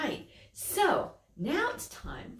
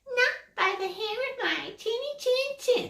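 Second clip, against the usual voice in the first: much higher squeaky pig voice; little pig voice. Acted. Little pig refusing the wolf, high voice. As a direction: answer high and tiny like a piglet. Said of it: "the little pig says".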